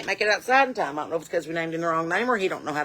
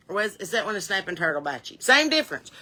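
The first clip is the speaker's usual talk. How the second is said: normal talking voice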